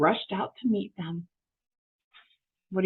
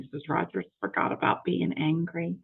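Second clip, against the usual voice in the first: plain reading voice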